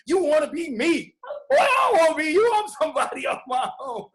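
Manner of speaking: mock the teen's loud, incensed protest; son going off on dad. Son arguing with his dad in a bathroom, heated and loud. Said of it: "him just going in on me".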